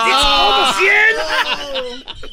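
very high, loud, drawn-out protest; outraged shriek. Acted. Lover hearing boy's new price, outraged shriek. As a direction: shriek it in disbelief, stretching it out.

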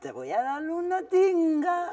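put-on slow, laughing little kid's threat; a child parroting mom, giggling; slow giggly copycat threat; little girl copying. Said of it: "volteé a Michelle y me dice".

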